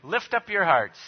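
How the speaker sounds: high, loud, ringing call to the congregation; liturgical call. Real, not acted. Pastor opens the liturgy for the congregation, ringing call. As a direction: lift the greeting out to the whole room.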